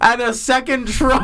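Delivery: high, cracking up mid-sentence; laughing. Real, not acted. Storyteller springing a second troll on friend, cracking up. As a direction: break into helpless laughter as you tell it.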